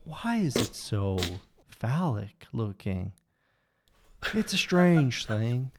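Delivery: lightly done slow Southern Texan drawl; a Southern Texan drawl, slow and dreamy; imitating dad's Texan drawl. Son imitating his father's Southern Texan drawl. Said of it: "let's see if I can try and channel my father".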